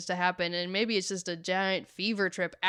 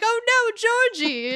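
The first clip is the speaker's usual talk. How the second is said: calling out in alarm; 11 semitones higher, 5 dB louder than usual. Retold or imitated. Kids calling after runaway Georgie.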